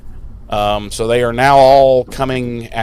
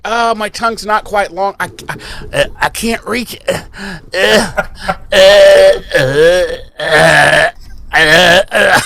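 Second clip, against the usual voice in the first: higher, hammed-up strained grunts building louder; mock struggling to reach with his tongue, uh uh; straining vampire; 5 semitones higher, 3 dB louder, slower than usual. Spike straining his tongue toward the cut, mock struggling grunts. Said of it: "he's just like".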